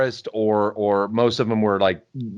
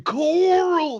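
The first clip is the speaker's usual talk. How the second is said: very high, stretched-out hammy cry; Rick from The Walking Dead. Retold or imitated. Mocking a British actor's TV role, exaggerated drawl. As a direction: wail the name in a mock Southern drawl. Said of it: "that dude's British as fuck".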